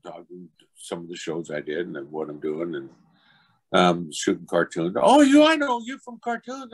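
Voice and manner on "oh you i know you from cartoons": put-on loud, high-pitched child's recognition; imitated little kid. Kid recognizes actor from cartoons, excited high child voice. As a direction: exclaim as a thrilled small boy. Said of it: "his little kid".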